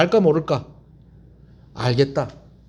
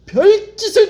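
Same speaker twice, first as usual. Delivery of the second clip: excited exclamation; 13 semitones higher, 6 dB louder, slower than usual. A narrator's reading. Sailors trying everything.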